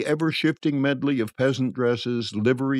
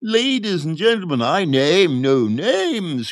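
high, grand quack's announcement; pompous charlatan crying out; impressive cry; 7 semitones higher, 4 dB louder, slower than usual. A narrator's reading. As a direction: declaim with drawn-out showman's weight. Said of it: "cried the quack impressively".

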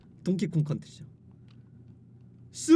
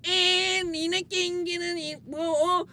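taunting fanboy; 4 semitones higher, 5 dB quieter, slower than usual. Retold or imitated. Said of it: "하면서 이제 서로 치고받고 싸웠던".

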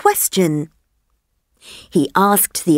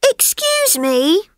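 higher, eager little elephant voice; curious elephant child; 10 semitones higher than usual. Acted. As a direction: ask like a wide-eyed young elephant. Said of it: "said the elephant's child".

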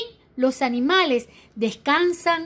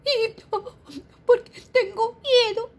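high whimpering little voice; mock crying child; 12 semitones higher than usual. Baby elephant tells rhino he is scared, whimpering.

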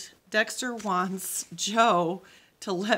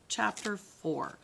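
ordinary delivery, nothing marked

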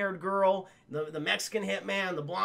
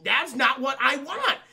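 slow, loud, raised protest; mock angry objection; 6 semitones higher, 6 dB louder, slower than usual. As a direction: act out indignation, dragging each word. Acts Reynolds objecting.

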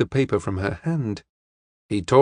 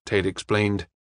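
plain reading voice

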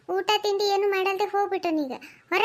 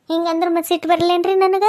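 much louder, furious complaint; wife erupting at her mother-in-law about the husband; angry outburst; 9 dB louder than usual. Acted. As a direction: let the anger burst out.

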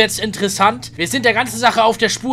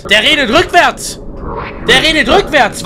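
excited shout; 6 semitones higher, 8 dB louder than usual. Real, not acted. Shocked discovery of reversed voice.

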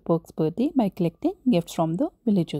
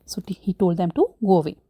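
normal talking voice

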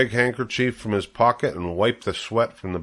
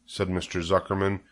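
usual speaking voice

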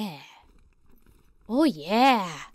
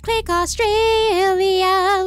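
slow, higher, louder title call; drawn-out announcer voice; 7 semitones higher, 4 dB louder, much slower than usual. Pretend. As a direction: announce the title like a show host.

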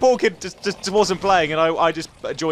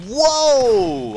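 long, loud, high whoa; startled whoa; 8 semitones higher, 5 dB louder than usual. Real, not acted. Gamer hit by sudden lag, startled. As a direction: cry out at a sudden shock.

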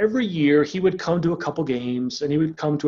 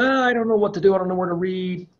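higher-pitched mock whine of reluctance; reluctant complaining; 6 semitones higher than usual. Coach mimicking a reluctant Bible reader, whiny complaining. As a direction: drag out a groaning excuse, pitched up. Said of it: "And you're like".

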